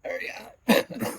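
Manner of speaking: breathless, strained whisper; strained from pepper heat. Real, not acted. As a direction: push the words out through burning heat. Just ate a very hot pepper.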